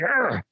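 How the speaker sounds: a sharp, high frustrated growl at a stuck jar lid; a quick burst of frustration, half-mocking yourself. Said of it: "I get frustrated and I'm like".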